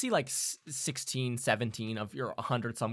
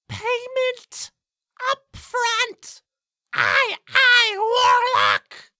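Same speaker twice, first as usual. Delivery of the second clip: very high, loud squawky bird voice; demanding blackbird; 11 dB louder, much slower than usual. Acted. Blackbird haggling with the player, loud high screech. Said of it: "and will say".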